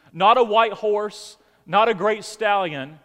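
slow, loud emphatic build; preaching. Acted. Pastor contrasting horse and donkey, loud and slow. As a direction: hammer each phrase.

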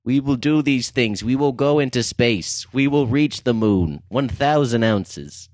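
put-on grand Boston accent speech; JFK accent. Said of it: "This is a Jack Kennedy accent".

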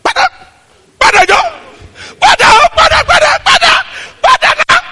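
very high, loud nonsense barks; imitated frantic barking. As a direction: yap rapidly like a dog on the attack. Mimics the dog's chase.

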